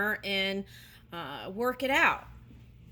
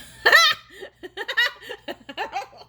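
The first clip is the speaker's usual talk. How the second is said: high, loud real laugh; burst of laughter; 14 semitones higher, 9 dB louder than usual. Real, not acted. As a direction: crack up laughing. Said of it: "I'm sorry, I'm laughing".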